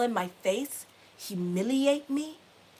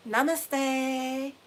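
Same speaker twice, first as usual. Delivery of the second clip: slow, soothing guru-style delivery; mock serene calm; much slower than usual. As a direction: play the newly peaceful yogi. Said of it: "And now I'm like".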